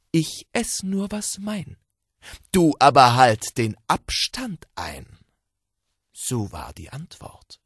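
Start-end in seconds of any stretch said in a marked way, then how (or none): 0.1-5.2 sly fox. Acted. Fox refusing the wolf.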